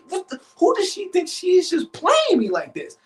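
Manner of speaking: high-pitched indignant outburst; worked-up, incredulous inner voice; retold rising rage. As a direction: voice climbs in retold outrage. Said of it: "a rage almost coming in".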